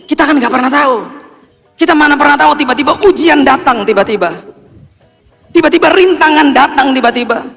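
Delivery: fired-up sermon delivery; preaching at full force. Acted. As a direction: preach it up, building. Preaching climax, raised voice.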